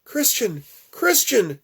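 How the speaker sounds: high, loud urgent call. Acted. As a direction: pull him out of the nightmare. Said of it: "Her voice is urgent".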